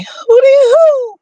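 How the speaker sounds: high slow falsetto hoot; hooty hoo call. Acted. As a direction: sing out a playful owl-like hoot. Greeting Gemini listeners, calling out.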